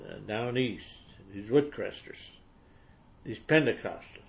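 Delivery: ordinary talk, nothing marked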